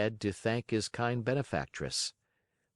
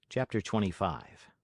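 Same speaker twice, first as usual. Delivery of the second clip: ordinary delivery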